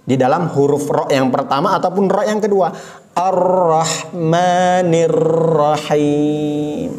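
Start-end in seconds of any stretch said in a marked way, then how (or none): 3.1-6.9 Ar-Rahmanir with the ro held a moment; slow correct tajwid recitation. Shown as a demonstration. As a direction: recite Ar-Rahmanir Rahim slowly, holding the doubled ro. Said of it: "Yang benar, ditahan sebentar".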